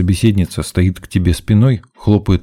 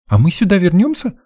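put-on eager, very high girlish question; perked up; 13 semitones higher than usual. A narrator's reading. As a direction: brighten up and ask high, hopeful. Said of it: "оживилась девчонка". (оживлённо, высоким девичьим голосом)

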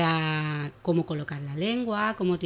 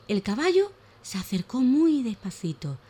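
put-on lively, animated reading of a children's tale; expressive children's story voice; storytelling for children; 2 semitones higher, 3 dB louder than usual. A narrator's reading. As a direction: tell it like a bedtime story for little ones.

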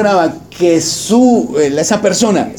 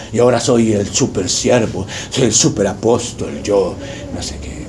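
mock boastful servant; 8 semitones lower, 4 dB quieter than usual. Mocking a boastful preacher.

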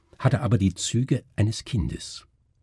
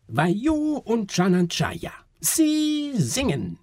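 very high, stretched-out showwoman's screech; screeching woman's voice; 14 semitones higher, much slower than usual. A narrator's reading. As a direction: screech the announcement, drawing out each word. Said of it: "kreischte die Stimme wieder". (kreischende Frauenstimme, hoch und gedehnt)

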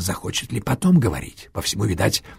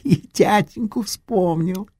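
sweet drawling menace; 8 semitones higher than usual. A narrator's reading. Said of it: "Мишин голос стал ласковым, тягучим".

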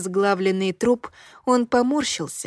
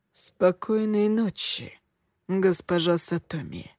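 slow, icy and quiet; a lightly voiced cold killer; cold low voice; 2 semitones lower, 4 dB quieter than usual. A narrator's reading. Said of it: "низким, ледяным голосом".

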